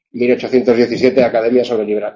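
ordinary delivery

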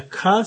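"K" voiced as the sound "kuh"; "K" as a short hard "kuh". Shown as a demonstration. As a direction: say "K" as a sound, kuh, not the letter name. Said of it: "pronounced with a K sound".

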